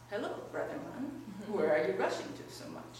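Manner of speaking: a hint of a low wolf voice; storybook wolf. A narrator's reading. Wolf greets a man walking through the forest, lightly voiced low. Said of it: "And the wolf says".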